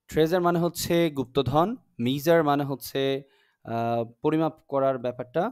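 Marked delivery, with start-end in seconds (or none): none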